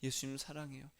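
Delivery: hushed, tender "예수님 사랑해요" said very softly; soft prayer demo. Shown as a demonstration. Pastor showing the congregation how to pray, hushed and tender. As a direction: murmur it like a loving prayer. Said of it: "그 기도만 하세요".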